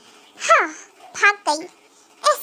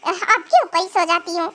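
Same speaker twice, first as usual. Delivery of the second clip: lower, sarcastic echo of her words; mocking repeat; 8 semitones lower than usual. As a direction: parrot her words back with scorn. Husband mockingly repeats wife's words. (ताना मारते हुए उसकी बात दोहराना)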